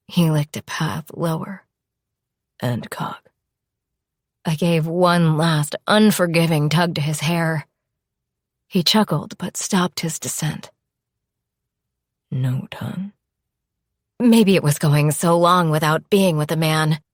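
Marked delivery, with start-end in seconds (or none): 2.6-3.3 lower, softer man's voice; low husky male voice. Acted. Lover teasing in bed. 12.3-13.1 hushed, airy protest; breathy whisper. Acted. She refuses in bed.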